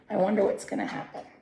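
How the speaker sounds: ordinary delivery, nothing marked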